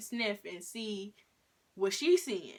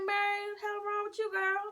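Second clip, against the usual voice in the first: much higher, scolding the dog in disbelief; puzzled call to the dog; 13 semitones higher than usual. Retold or imitated. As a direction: call out to your dog like she's lost her mind. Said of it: "I'm like".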